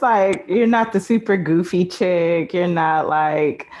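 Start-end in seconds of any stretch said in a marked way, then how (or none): none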